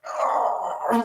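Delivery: a high, breathless "Oh"; pained gasp. Retold or imitated. Hit by a can, a sudden high gasp. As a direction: catch your breath as if struck.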